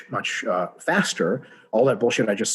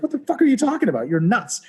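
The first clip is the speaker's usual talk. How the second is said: much higher, fast, disbelieving; incredulous outburst; 10 semitones higher than usual. Retold or imitated. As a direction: blurt it out in total disbelief. Incredulous reply to the stubborn pusher.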